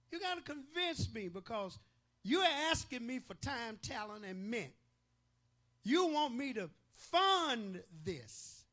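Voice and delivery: a hint of a loud doubting congregant; skeptical member. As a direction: make the preacher earn your money. Voicing a doubting church member.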